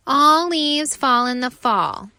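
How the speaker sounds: mocking leaves. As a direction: scoff at his effort, loud. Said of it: "the other leaves say".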